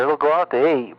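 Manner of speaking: ordinary talk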